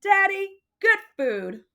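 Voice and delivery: high sing-song call; proud kid. Acted. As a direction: cheerfully insist. Child presenting candy.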